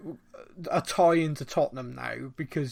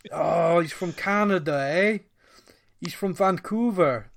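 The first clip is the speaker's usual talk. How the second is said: a light mock Canadian accent, ending on "eh"; playful stab at a Canadian accent, lightly done; 3 semitones higher, 4 dB louder than usual. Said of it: "What was that impression that you just did there?".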